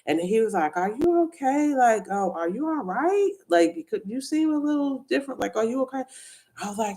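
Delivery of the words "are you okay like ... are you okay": higher-pitched, gently checking voice; concerned questioning. Retold or imitated. Doctor checking on a coworker at her desk, concerned. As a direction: imitate someone worriedly checking in.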